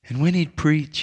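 ordinary talk, nothing marked

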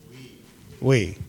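We set apart and weighted; We answered with weight; stress on We. Shown as a demonstration. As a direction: land hard on the word We. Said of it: "what's the next word?".

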